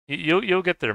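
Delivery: high, loud cheering on; loud encouragement. Real, not acted. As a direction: lift him up with a shout. Encouraging a struggling friend.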